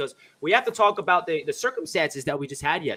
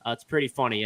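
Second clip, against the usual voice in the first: ordinary talk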